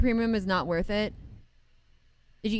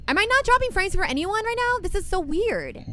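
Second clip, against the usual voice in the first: puzzled; 11 semitones higher than usual. Real, not acted. Puzzled by dropped frames.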